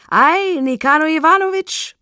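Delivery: loud, high, ringing greeting; bellowing, clanging tenor. A narrator's reading. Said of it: "brüllte der unerwartete Bürger mit klirrendem Tenor". (mit klirrendem Tenor brüllend)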